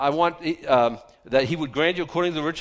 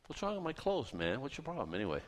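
muttered complaint; 12 dB quieter than usual. Retold or imitated. Mock defensive muttering.

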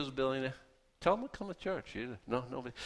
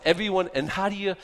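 usual speaking voice

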